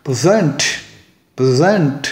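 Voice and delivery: Present as a verb, pri-ZENT; present with all the weight on the last syllable; stress on second syllable. Shown as a demonstration. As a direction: swallow the first vowel of present and punch ZENT. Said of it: "मैंने pray को stress ही नहीं किया".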